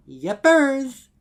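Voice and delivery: very high, loud, bright chirp; forced cheer. Acted. Mare forcing cheer so the stallion won't notice her leg. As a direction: fake it perky to hide the pain.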